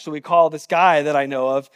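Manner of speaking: ordinary delivery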